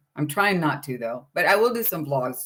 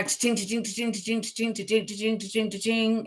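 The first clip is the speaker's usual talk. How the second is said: cash register sound; 4 semitones higher, 5 dB quieter than usual. Retold or imitated. Money adding up, cash register.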